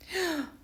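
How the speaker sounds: quick high startled gasp; sharp gasp. A narrator's reading. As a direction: gasp at the flash. Reacting to camera flash.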